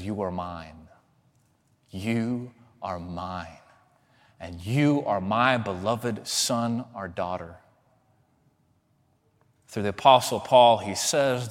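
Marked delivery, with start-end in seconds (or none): 0.0-7.6 tender voice of Christ. Retold or imitated. Voicing Christ's tender reply.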